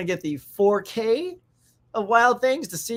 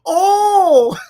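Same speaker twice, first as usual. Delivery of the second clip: high, loud mock gasp of shock; shocked crowd gasp; 12 semitones higher, 12 dB louder than usual. Imitating the theater crowd reacting to Kevin Bacon, loud shocked gasp. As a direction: gasp like a stunned audience. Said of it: "even the dudes in the theater go".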